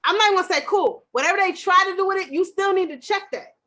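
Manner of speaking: rising fired-up rant; heated rant. Real, not acted. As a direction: push the point hard and fast.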